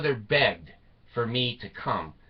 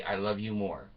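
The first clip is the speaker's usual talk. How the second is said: tender, soft; 4 semitones lower, 7 dB quieter than usual. Retold or imitated. Telling his wife every morning he loves her more, softly. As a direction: murmur it lovingly to your wife.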